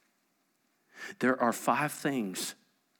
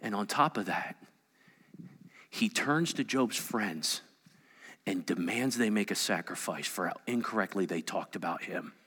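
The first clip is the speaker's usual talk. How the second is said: normal talking voice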